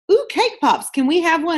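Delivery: high-pitched eager begging, imitated; mock excited pleading. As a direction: play husbands cooing over treats, voice jumping up high. Voicing both husbands begging for treats.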